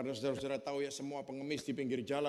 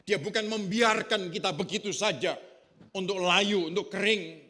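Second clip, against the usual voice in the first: full-force preaching; 5 semitones higher, 8 dB louder than usual. Acted. Pastor to his congregation about being planted, preaching at full force. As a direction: pound out each phrase to the congregation.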